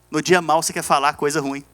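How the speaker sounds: normal talking voice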